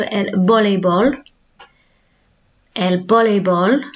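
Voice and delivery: voleibol with the v as a soft b; Spanish el voleibol. Shown as a demonstration. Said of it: "a V is pronounced more as, like, a soft B".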